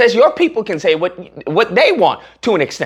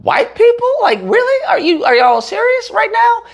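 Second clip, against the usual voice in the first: incredulous. Real, not acted.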